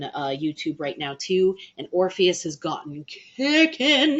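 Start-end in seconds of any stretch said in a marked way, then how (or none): 3.0-4.2 hyped emphasis. Real, not acted. Hyped praise of the song.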